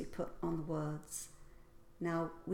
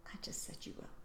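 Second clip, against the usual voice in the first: whispered aside; 13 dB quieter, much faster than usual. Shown as a demonstration. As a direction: whisper it behind your hand. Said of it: "I didn't say you were stupid".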